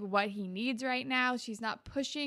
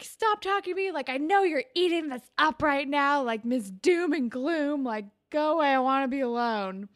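raised, louder imitation of an angry teen; retold angry outburst; 5 semitones higher, 7 dB louder than usual. Recapping Craig snapping at Ashley trying to console him, mock angry. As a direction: lash out, mimicking a boy pushing someone away. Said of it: "he's really angry".

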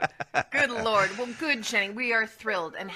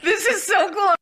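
laughing; 12 semitones higher, 5 dB louder than usual. Real, not acted. Thrilled, laughing.

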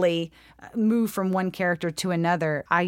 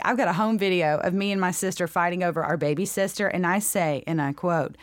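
usual speaking voice

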